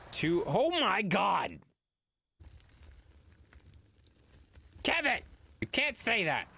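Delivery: high, slow shocked oh my God; shocked outburst. Real, not acted. Streamer shocked by a chat message, squealing.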